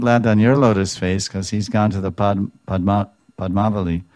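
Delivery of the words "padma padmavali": normal talking voice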